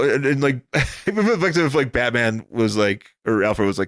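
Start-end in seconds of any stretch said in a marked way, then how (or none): none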